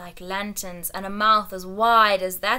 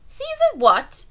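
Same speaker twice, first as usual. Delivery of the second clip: astonished; 8 dB louder than usual. Acted. King astonished question.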